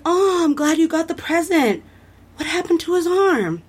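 imitated kindly man, pitched high; high-pitched retold man's voice; friendly man's voice. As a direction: greet a child as the man at the door, lifting the pitch. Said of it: "you hear this man's voice".

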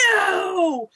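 a sudden, high, anguished cry of no; anguished shout. Retold or imitated. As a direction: cry out as your friend is blown up. Josh sees friend killed.

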